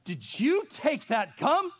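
slow, high, loud stern demand; stern mom. Retold or imitated. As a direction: drag it out like a suspicious mother. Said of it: "mother interrogating son".

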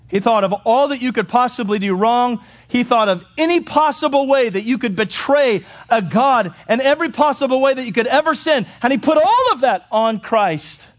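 high, building, emphatic preaching; full-force pulpit delivery; impassioned preaching. Acted.

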